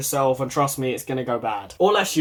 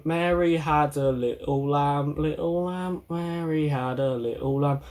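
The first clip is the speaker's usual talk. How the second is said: monotone; 2 semitones lower, 4 dB quieter than usual. Shown as a demonstration. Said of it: "Don't talk like this".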